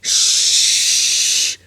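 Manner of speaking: sharp hushed shh; cautioning shush. A narrator's reading. Man hushing Milo so nobody hears, a sharp shush. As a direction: hush him quick, warning. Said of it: "he cautioned".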